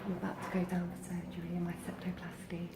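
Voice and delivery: hushed, breathy whisper before surgery; nervous whisper. Real, not acted. Whispering to viewers right before surgery, nervous. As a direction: whisper it nervously, barely voiced.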